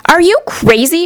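high indignant shout. A narrator's reading. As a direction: scoff at the offer. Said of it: "shouted a woman".

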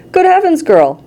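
high screech dropping on the last word. A narrator's reading. Mother appalled at daughter, high narrated screech. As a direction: start very high in alarm. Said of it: "screeched Missus Beauregard".

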